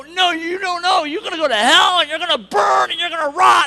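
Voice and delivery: loud, rising condemnation; mock hellfire rant. Speaker mocking a hellfire reply to an atheist friend, loud rant. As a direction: preach fire and brimstone at him. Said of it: "I don't go".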